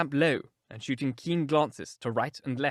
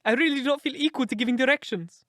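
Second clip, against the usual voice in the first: high, helpless little-man voice; pleading; 11 semitones higher, 4 dB louder than usual. A narrator's reading. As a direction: beg off nervously, pitched high. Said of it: "pleaded Thaddeus Sholto".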